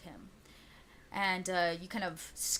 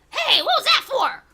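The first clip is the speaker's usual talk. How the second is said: much higher, much louder shout; startled goblin shouting at the thrower; angry goblin yell; 15 dB louder than usual. Acted. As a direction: yell it as a goblin covered in hot ash. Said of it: "The goblin's like".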